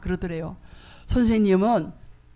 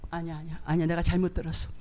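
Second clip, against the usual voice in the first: hushed denial; 5 semitones lower, 7 dB quieter, faster than usual. Retold or imitated. Denying what God said.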